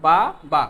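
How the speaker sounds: বা then বা, each syllable said on its own with a short break; syllables said apart. Shown as a demonstration. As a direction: split বাবা into বা and বা, clearly. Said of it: "এই যে দুইবার উচ্চারণ করলাম".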